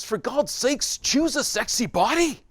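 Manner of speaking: put-on high, loud English accent; hammed-up English accent. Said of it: "he had an English accent".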